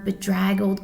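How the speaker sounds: plain reading voice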